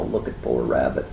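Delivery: plain reading voice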